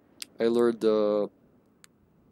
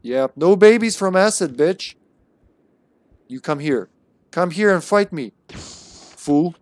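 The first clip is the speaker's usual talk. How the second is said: taunting yell; 6 semitones higher, 6 dB louder than usual. Real, not acted. Yelling insults at spiders.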